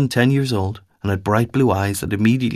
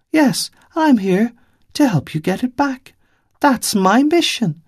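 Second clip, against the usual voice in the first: put-on young girl's voice; 11 semitones higher than usual.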